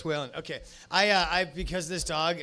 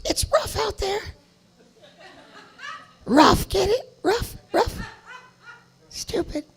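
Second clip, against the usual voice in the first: much higher, louder squeaky puppy voice; dog voice; 9 semitones higher, 9 dB louder than usual. Pretend. Comic voices his dog Waylon telling dog jokes, high puppy voice. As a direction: play a silly little dog telling jokes. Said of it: "my dog, Waylon, and he wanted to tell a few jokes".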